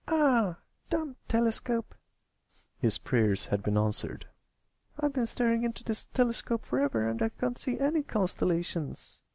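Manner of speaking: put-on high, frustrated young filly; a filly annoyed at her telescope; fuming little filly. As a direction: huff at the telescope. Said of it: "Sweetabelle fumed".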